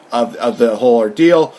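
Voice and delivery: usual speaking voice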